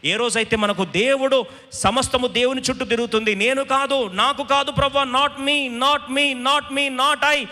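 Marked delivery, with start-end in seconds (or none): 1.7-7.5 high, insistent cry of self-denial; fervent surrender. Acted. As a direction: plead it to God in rising fervour. Acts a self-emptying prayer to God. (ఆవేశంతో ప్రార్థన)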